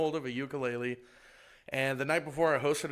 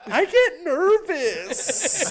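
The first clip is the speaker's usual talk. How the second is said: loud, drawn-out squeaky confession; hammed-up nervous squeal; high-pitched mock excuse; 12 semitones higher, 8 dB louder, much slower than usual. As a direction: wail the excuse up high and slow. Acted excuse after farting on stage.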